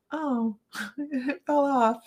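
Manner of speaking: slow, lightly voiced mock fretting with a laugh; mock dismay. Host mocking a fuss she would never make, lightly played. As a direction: play at fussing over a small mishap. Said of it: "you'll never hear me go".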